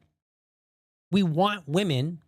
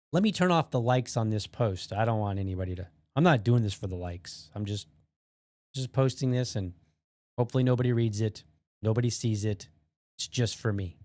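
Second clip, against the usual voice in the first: mock humble poster; 7 semitones lower than usual.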